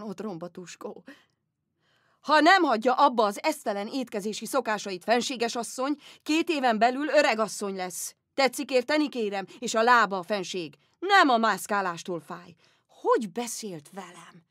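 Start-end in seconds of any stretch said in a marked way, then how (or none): 2.2-12.5 loud, blunt doctor lecturing; shouting doctor. Retold or imitated. Rude Dutch doctor scolding the empress, loud and blunt. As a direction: bark the diagnosis at her. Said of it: "kiabált, mint apa".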